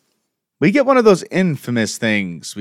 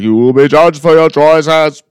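higher and louder, booming like a cosmic narrator; echoing Watcher voice; 4 semitones higher, 6 dB louder than usual. Retold or imitated. The Watcher speaking during the spells, a mock booming voice. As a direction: intone it like the Watcher passing judgment. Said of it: "he was like".

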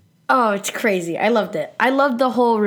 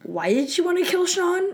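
mock puzzled; 11 semitones higher than usual. Reading her own note in mock puzzlement.

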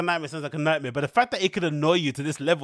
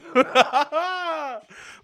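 high, drawn-out cackle; real high laugh; 11 semitones higher, 3 dB louder than usual. Real, not acted. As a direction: burst out laughing at your own joke. Laughs at imagined mansplaining.

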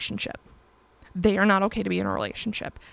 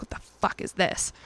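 hushed retold demand; 2 semitones lower, 6 dB quieter than usual. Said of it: "I'm like".

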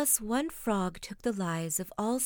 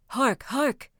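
urgent louder, higher call; crying out; 4 semitones higher, 5 dB louder than usual. A narrator's reading. Master Schultz warning his comrades, crying out. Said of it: "cried he to his comrades".

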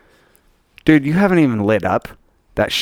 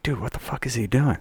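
whispered; 2 semitones lower, 9 dB quieter than usual. Retold or imitated. Retold confused inner thought.

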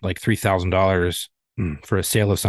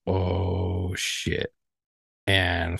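drawn-out, softer, lower imitation of dismay; mock dismay; 4 semitones lower, 5 dB quieter, much slower than usual. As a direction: let a retold realization sink in quietly. Retells second agent's dismayed reaction.

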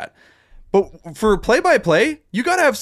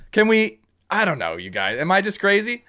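normal talking voice

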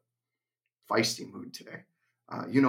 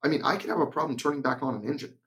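ordinary talk

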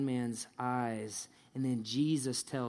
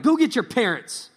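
demanding; 9 semitones higher, 10 dB louder than usual. Acted. Pharisees' demand acted out.

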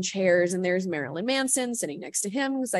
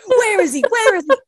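much higher, louder hammed-up outrage; mock furious friend; 13 semitones higher, 12 dB louder than usual. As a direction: play the protective buddy ready to fight.

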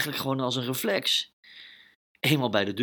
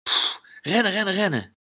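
high, loud urgent rennen; panicked running; 9 semitones higher, 4 dB louder than usual. Acted. Lucas fleeing the barking dog, high panicked rennen. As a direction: urge yourself to run away fast.

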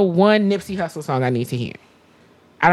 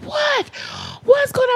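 much higher, urgent grandma voice; startled grandma, pitched way up; grandmother's alarmed shout. Retold or imitated. Grandmother hearing a scream, alarmed and pitched high. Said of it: "My grandmother, she was like".

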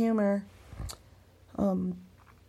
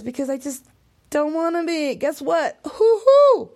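climbing higher and louder into a whoop; defiant whoop; 7 semitones higher, 8 dB louder than usual. Real, not acted. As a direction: build up to a gleeful cheer. Defiant build to a cheer.

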